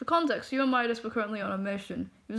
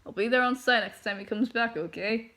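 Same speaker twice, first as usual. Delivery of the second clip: plain reading voice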